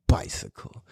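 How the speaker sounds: a whispered single word; hushed whisper. Real, not acted. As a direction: breathe it out quietly.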